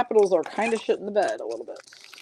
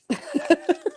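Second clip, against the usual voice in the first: bright burst of real laughter, pitched up; high laugh; 11 semitones higher than usual. Real, not acted. Host laughing high at a chat comment.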